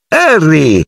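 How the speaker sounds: high-pitched mock-grand greeting; exclaiming. A narrator's reading. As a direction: exclaim the name with a big high swoop down. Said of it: "esclamò Fred dando una gomitata".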